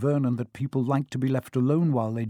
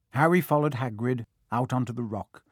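plain reading voice

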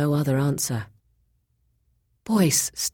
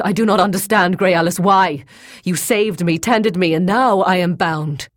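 loud, raised-pitch bewildered plea; distressed protest; 5 semitones higher, 7 dB louder than usual. A narrator's reading. Boyce to Grey Alis who saved then bound him, distressed protest. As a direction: protest in alarm, demanding an answer. Bound captive demands an explanation.

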